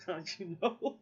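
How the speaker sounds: laughing through the comeback; laughing retort. Retold or imitated. Answering the man's taunt about her dad, laughing. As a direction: tease him while laughing. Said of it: "I'm like".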